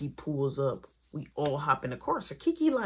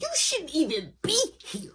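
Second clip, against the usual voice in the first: heated; 12 semitones higher, 3 dB louder than usual. Retold or imitated. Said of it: "I'm still heated".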